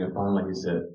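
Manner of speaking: normal talking voice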